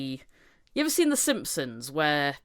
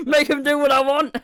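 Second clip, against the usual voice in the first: higher, louder whiny demand through laughter; mock demanding parent, laughing; 8 semitones higher, 9 dB louder than usual. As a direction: whine it like a spoiled parent.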